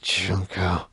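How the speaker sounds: weak and faint. Acted. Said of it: "And I'm just like".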